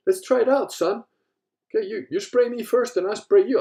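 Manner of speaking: father proposing the pepper spray test, hammed-up; hammed-up dad voice. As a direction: play the eager father daring his son.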